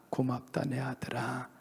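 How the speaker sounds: whispered, tender and hushed; whispered thanks. Retold or imitated. The Lord whispering thanks to his son, hushed whisper. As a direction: breathe it like a tender secret. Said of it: "주님이 이 안에서 짠하게 생각하십니다".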